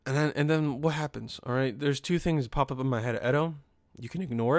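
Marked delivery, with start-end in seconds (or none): none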